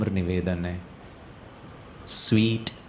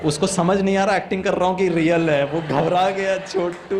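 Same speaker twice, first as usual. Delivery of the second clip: excited storytelling; 7 semitones higher, 5 dB louder than usual. Acted. Speaker describing the frightened child, loud and excited. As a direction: tell it breathless and big. Child scared, acting or real.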